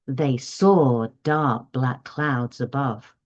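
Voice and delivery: slow, expressive model reading. Shown as a demonstration. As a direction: read it slowly with clear tune for the child to copy. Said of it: "Then the next part".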